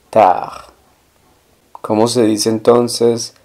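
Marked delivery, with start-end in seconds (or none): 0.1-0.7 Tard said "tar", no D at the end; silent final D. Shown as a demonstration. Teacher repeating French "late" for learners, Tard without final D. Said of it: "la D del final no se pronuncia".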